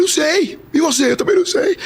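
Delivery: very high mock-weepy exchange; tearful. Two strangers crying at the door, tearful. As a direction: trade lines in a high crying voice. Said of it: "os dois chorando".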